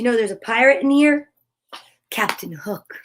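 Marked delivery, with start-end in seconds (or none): 2.1-2.9 soft, lower unvoiced whisper. Real, not acted. Warning about the pirate, a spooky whisper. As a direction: whisper the villain's name ominously.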